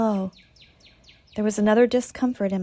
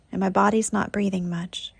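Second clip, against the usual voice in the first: ordinary talk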